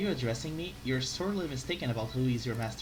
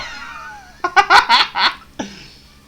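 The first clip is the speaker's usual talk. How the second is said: high loud burst of laughter; 14 semitones higher, 14 dB louder than usual. Acted. Loud laugh after shout.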